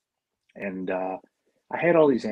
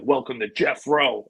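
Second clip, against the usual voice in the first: [name] intro parody; 2 semitones higher, slower than usual. Retold or imitated. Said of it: "beginning of Doctor Dre".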